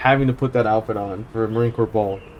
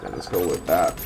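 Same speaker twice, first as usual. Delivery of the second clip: much lower, softer joke voice; mock deep voice; 9 semitones lower, 6 dB quieter than usual. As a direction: drop the voice way down for a goofy repeat. Repeats choice in put-on voice.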